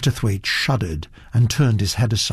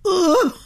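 high-pitched groan of disgust; short, high noise of revulsion; disgusted "ugh". Acted. Duchess disgusted by a painting.

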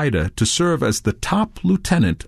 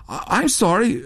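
higher, stammered apology; stammering; 10 semitones higher than usual. A narrator's reading. Touré apologizing to Redbeard, stammering. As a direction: stumble over the opening of the apology. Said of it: "Touré stammered".

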